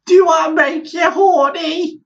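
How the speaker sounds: shouted high-pitched randy sweep; silly falsetto sweep. Pretend. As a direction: shriek it as a randy chimney sweep.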